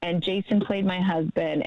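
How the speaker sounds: usual speaking voice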